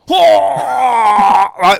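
loud, high yelp; hammed-up shouted demo; mock cry of pain. Shown as a demonstration. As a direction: yell as if hit in the handbag. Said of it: "I'm gonna enact it for you".

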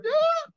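short high-pitched "Ah!" scream; mock panicked yelp, high and loud; stressed scream. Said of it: "so stressed out".

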